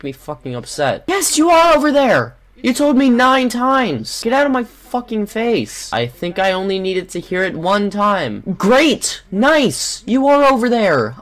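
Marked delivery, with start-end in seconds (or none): 1.1-5.9 exasperated yelling. Real, not acted. Yells back at nagging robot. 8.6-11.2 sarcastic exasperation. Real, not acted. Sarcastic cheering at nagging robot.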